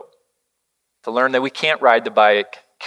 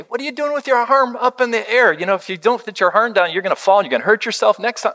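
mock scolding; 10 semitones higher, faster than usual. Father scolding son, retold.

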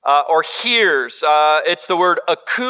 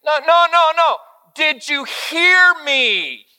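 high, insistent, slowing on the question; exasperated dad; 9 semitones higher, slower than usual. Retold or imitated. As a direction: press your kid for a real answer. Frustrated dad retold.

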